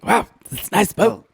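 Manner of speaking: Stacy voice; playing Stacy. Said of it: "playing Stacy".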